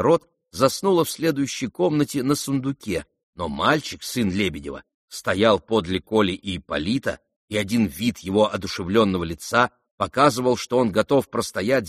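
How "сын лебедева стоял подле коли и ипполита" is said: ordinary delivery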